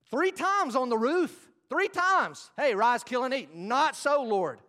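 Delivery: high, loud pulpit retelling; full-force preaching. Real, not acted. Preacher retelling Peter's rooftop vision to congregation, full-force preaching. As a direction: cry out the story from the pulpit.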